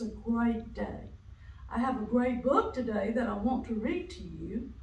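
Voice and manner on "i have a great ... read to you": usual speaking voice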